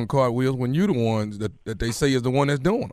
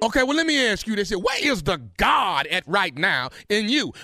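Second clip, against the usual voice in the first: indignant; 7 semitones higher than usual. Acted. Challenging her faith, heated.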